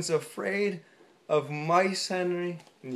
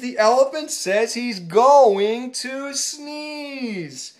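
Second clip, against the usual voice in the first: slow warning; 5 semitones higher, 5 dB louder, much slower than usual. Acted. Birds warn, slowed and loud.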